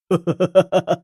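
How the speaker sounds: booming staccato ho-ho laugh; menacing villain's laughter; evil genie laugh. Acted. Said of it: "हंसते हुए रामदीन से कहता".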